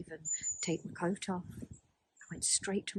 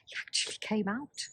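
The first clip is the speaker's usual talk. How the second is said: usual speaking voice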